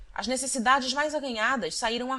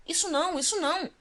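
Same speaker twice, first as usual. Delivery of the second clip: higher, urgent protest cutting in; energetic interruption; 9 semitones higher, faster than usual. A narrator's reading. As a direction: cut him off firmly, pitch up. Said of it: "interrompeu a boa senhora com energia". (interrompendo com energia, voz mais aguda)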